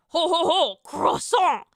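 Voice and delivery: mock French laugh into an exaggerated accent; heavy French accent. Shown as a demonstration. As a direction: ham up a silly French accent. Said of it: "most offensive French accent for fun".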